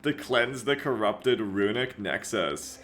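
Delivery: slow, grave trailer voice; drawn-out epic narration. Acted. Reads the trailer narration aloud.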